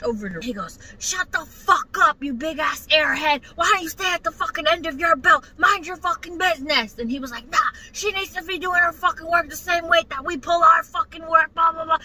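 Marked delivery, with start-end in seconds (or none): 1.0-7.0 high-pitched, heated telling-off; mock screaming, pitched up; retold screaming match. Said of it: "they're screaming at each other like this". 7.5-12.0 raised, pitched-up angry comeback; mock screaming rant; retold screaming match. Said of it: "they're screaming at each other like this".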